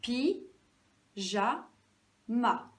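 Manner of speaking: "Pi, ja, ma" as three separate beats; syllable by syllable. Shown as a demonstration. Teacher counting syllables with pupils, syllable by syllable. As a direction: count out "Pi, ja, ma" with long gaps. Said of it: "dire et compter en même temps les syllabes".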